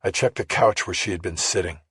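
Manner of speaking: ordinary delivery, nothing marked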